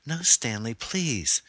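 high, desperate plea; frightened woman begging for her life; pleading. Acted. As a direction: beg him in a high voice. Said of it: "She grabbed his sleeve".

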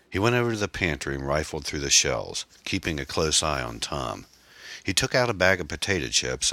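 ordinary delivery

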